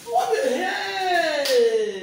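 long, drawn-out loud yell; loud, slow outburst of disbelief; shocked shout. Real, not acted. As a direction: stretch the cry out in shock. Mother shocked by what she finds.

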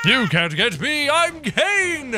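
high, taunting showman boast; Caine. Acted. Caine taunts the monsters chasing him, hammed-up boastful ringmaster. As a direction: crow it like a ringmaster. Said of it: "I'm Caine, the leader of the amazing".

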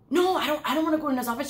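high-pitched, reluctant no, no; nervous protest. Retold or imitated. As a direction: back away from the dare, pitch up. Reluctant to sneak into office.

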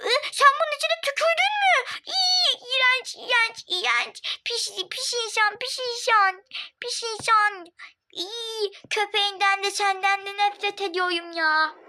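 high, whiny revulsion; disgusted outburst. Acted. Girl finds spit in her drink, disgusted and whiny. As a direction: recoil in disgust.

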